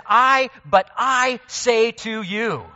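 slow, loud, high stress on I; emphatic I. Shown as a demonstration. Preacher booming Jesus' claim of authority, stress on I. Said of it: "It's an emphatic, uh, first person".

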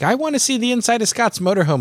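high, quavering grandma voice; old lady voice. Retold or imitated. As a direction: voice her like a sweet old grandma. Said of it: "she's like".

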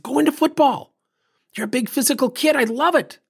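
high-pitched, eager cheering on; enthusiastic encouragement. Retold or imitated. Coach urging a kid toward football, enthusiastic. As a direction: send your pitch way up to back the idea.

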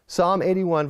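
ordinary talk

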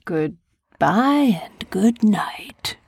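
slow whispered goodbye. Pretend. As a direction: draw it out in a whisper. Sign-off whispered slowly.

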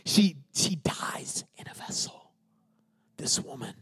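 soft, reverent whisper; hushed. Real, not acted. Solemn telling of her death.

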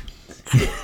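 real laugh at the punchline; loud unvoiced laugh. Real, not acted. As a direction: burst of breathy laughter. Both burst out laughing.

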